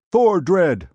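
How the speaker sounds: loud, high roar of a name; mob roar. A narrator's reading. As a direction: bellow it like a crowd hailing its hero. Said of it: "they roared".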